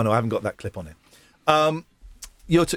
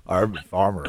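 a rustic West Country farmer; West Country accent; 8 semitones lower than usual. Pretend. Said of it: "I'm being West Country".

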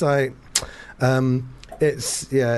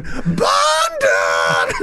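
mangled London; 5 semitones higher, 5 dB louder than usual. Retold or imitated. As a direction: bellow the city's name. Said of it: "he'd be going".

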